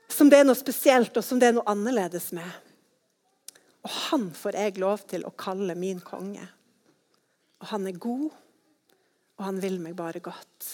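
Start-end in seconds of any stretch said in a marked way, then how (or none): 3.8-10.7 soft and much lower; quiet sincere ending; tender. Real, not acted. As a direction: speak it gently, like a promise. Tender close of children's sermon.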